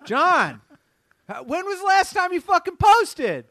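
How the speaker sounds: high, loud pretend tirade; mock furious boss. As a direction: yell at the team in hammed-up outrage. Mock boss berating the team.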